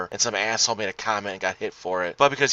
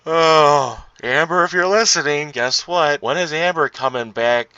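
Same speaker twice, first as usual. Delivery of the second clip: excited call-out; 4 semitones higher, 7 dB louder, slower than usual. Real, not acted. Calling out to absent co-host.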